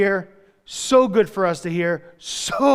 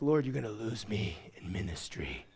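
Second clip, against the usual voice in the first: mock threat; 10 semitones lower, 16 dB quieter than usual.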